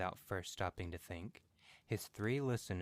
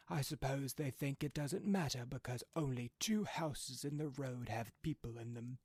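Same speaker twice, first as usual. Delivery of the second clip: put-on a higher-pitched old man griping about the mud, a touch of anger; grumbling old man; 6 semitones higher than usual. A narrator's reading. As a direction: complain like a fed-up old father. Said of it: "with sudden and unexpected violence".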